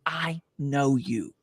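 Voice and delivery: lightly voiced lady who knows his voice; hushed woman at the registration table, a little awed; recognizing stranger. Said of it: "she goes".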